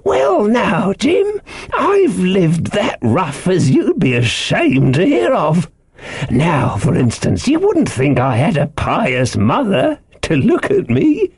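put-on high, slow old sailor's yarn; eccentric castaway, confiding. A narrator's reading. Ben Gunn's voice.

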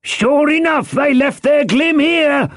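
high shout from above; fellow at window. A narrator's reading. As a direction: holler the discovery down to the road. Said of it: "said the fellow from the window".

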